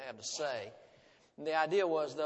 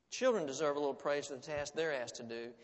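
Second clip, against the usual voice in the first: ordinary talk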